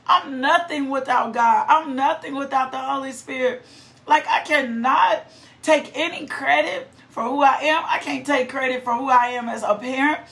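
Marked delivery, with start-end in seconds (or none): none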